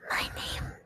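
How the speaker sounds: unvoiced soft whisper. Shown as a demonstration. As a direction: breathe it as a whisper. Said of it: "trying ASMR whisper".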